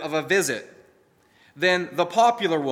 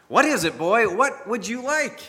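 jokingly; 5 semitones higher than usual. A narrator's reading. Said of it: "The butcher asked jokingly".

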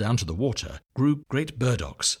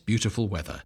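plain reading voice

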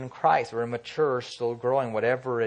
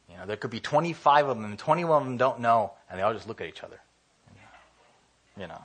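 usual speaking voice

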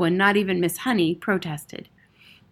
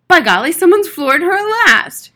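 loud, high jubilant exclamation; delighted, booming cheer; crying out, grinning; 10 semitones higher, 12 dB louder than usual. A narrator's reading. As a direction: crow it with a grin. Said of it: "cried one of the men, grinning".

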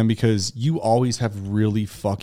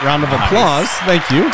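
excited; 5 semitones higher, 7 dB louder than usual. Real, not acted. Host announcing the new show.

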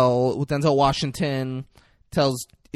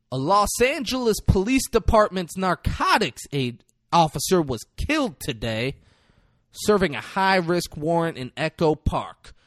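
raised-pitch newsreader impression; mock news report; 5 semitones higher than usual. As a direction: announce it like a TV news bulletin. Character recites imagined news report.

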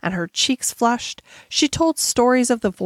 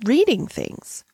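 higher, halting explanation; pitched up, pausing before the end; embarrassed stammer; 6 semitones higher than usual. A narrator's reading. Embarrassed schoolgirl explains her father's habits, haltingly. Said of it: "she stammered".

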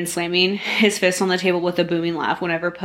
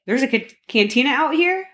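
surprised question; 7 semitones higher than usual. A narrator's reading. Poe surprised by cantina.

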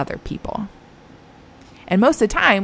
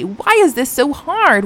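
normal talking voice

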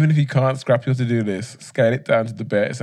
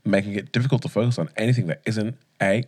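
ordinary talk

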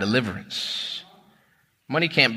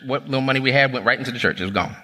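usual speaking voice

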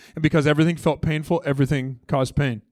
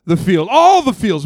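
loud, much higher, slow cry; emotional shout; 9 semitones higher, 8 dB louder, slower than usual. Real, not acted. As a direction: pour it out at full voice. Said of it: "I'm an emotional person".

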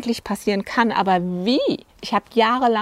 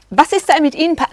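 retold angry outburst; 7 semitones higher than usual. Client snapping at his wife in therapy, mock angry. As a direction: voice jumps higher in a mock angry snap. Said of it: "da wurde ich wütend".

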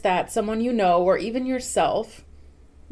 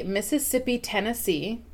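"Mississippi" falling, "Tennessee" rising, a touch softer; rhythmic cadence; 4 dB quieter than usual. Shown as a demonstration. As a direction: say "Mississippi, Tennessee" as a beat to copy. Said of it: "the same cadence that you use to say those words".

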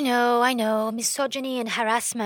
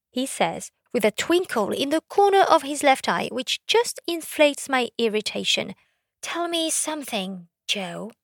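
ordinary talk

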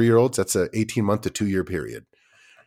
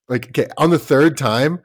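ordinary talk

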